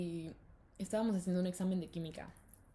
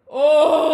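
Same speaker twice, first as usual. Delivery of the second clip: very loud, high "oh!"; imitated classroom uproar; crowd gasp; 6 semitones higher, 19 dB louder than usual. Whole class seeing the phone found, loud shocked "oh". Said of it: "Y todos".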